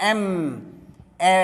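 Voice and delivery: এম, then its first vowel এ alone; teacher separating এ from এম; pronunciation demo. Shown as a demonstration. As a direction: sound out the letter name এম and isolate its opening এ. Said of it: "এটা উচ্চারণ".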